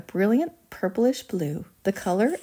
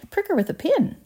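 high-pitched shouted suggestion; urgent call-out; 12 semitones higher than usual. A narrator's reading. Another father offers a remedy, urgent call-out.

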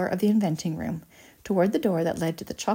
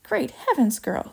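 raised-pitch horrified exclamation; mild screech; 6 semitones higher, slower than usual. A narrator's reading. Mother sees Violet swelling, mildly screeched horror. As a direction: exclaim in alarm, half screeching. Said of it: "screeched Missus Beauregard".

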